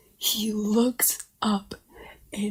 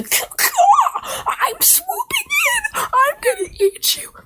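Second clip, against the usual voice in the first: squawking vulture; 6 dB louder than usual. Acted. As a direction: caw like a hungry swooping vulture. Vulture swooping in.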